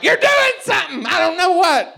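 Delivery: high, loud put-on voice; mock woman voice. Imitating the woman when made to repeat it, high. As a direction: squeal it in confusion.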